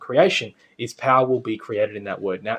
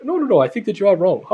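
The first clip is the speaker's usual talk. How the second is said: put-on Indian accent; 7 semitones higher, 4 dB louder than usual. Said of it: "he's got the Indian accent".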